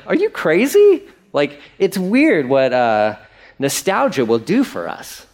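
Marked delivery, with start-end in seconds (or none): 0.1-1.0 high, loud disbelieving outburst; an aghast "are you crazy"; incredulous. Retold or imitated. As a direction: blurt it out like they've lost their mind. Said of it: "You're like".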